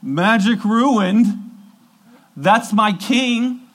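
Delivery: high, loud and slow anguished cry; grieving follower. Retold or imitated. Follower watching Jesus die cries out, high and slow. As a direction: wail it as your king dies. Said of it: "you're going".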